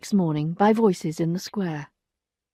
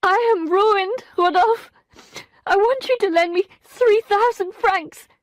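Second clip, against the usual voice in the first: Emma in tears begging for money; weeping; 13 semitones higher, 5 dB louder than usual. A narrator's reading. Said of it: "began to weep uncontrollably".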